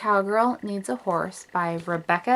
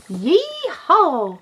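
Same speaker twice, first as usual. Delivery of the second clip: excited yee-haw; 7 semitones higher than usual. Acted. Girl whooping while lassoing.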